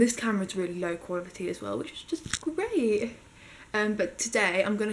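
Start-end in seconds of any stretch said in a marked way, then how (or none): 1.8-3.1 slow, high sarcastic drawl; dry sarcasm about the camera. Real, not acted. Complaining about low-quality camera.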